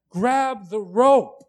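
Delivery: slow, loud shout to a drowning man; calling out. Pretend. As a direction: yell it slowly across the river so he hears. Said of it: "throw the rope and cry out and say".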